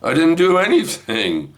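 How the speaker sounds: high-pitched indignant denial; defensive protest. Retold or imitated. Grandpa denying blame to wife, high defensive protest. Acted quarrel, defensive reply.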